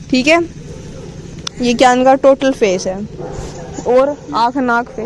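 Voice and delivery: normal talking voice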